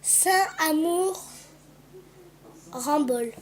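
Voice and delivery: each syllable drawn out slowly, reading the engraving. Shown as a demonstration. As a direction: spell out Saint-Amour Rambol slowly. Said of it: "Dessus, il y a écrit".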